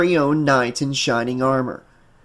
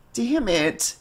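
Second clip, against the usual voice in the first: soft, higher-pitched moaned curse; weary moan; 6 semitones higher, 5 dB quieter than usual. A narrator's reading. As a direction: moan it under the weight of exhaustion. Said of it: "she moaned".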